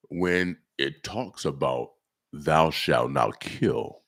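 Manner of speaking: low, slow God voice; Abraham. Acted. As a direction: pronounce it like God, deep and measured. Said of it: "my God voice, my Abraham voice".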